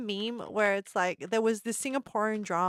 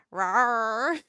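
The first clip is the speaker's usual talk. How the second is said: 1 mock rawr; 5 dB louder than usual. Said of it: "I would be like".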